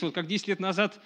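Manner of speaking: usual speaking voice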